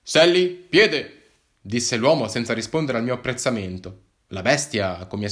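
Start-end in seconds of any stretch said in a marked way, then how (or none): 0.0-1.2 high, loud, very slow command with the name Sally stretched out; police officer ordering his dog back to his foot; dog handler command. Acted. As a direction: call the dog to heel with a firm, drawn-out Sally.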